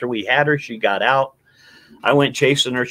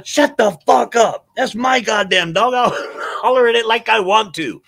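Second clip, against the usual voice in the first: retold angry hollering; 9 semitones higher than usual. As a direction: snap back at the neighbor. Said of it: "I'm hollering at the resident".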